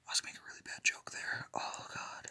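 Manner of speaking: hushed, quick confession in a whisper; whispered aside. Real, not acted. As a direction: whisper it fast to yourself, very softly. Embarrassed aside about own joke.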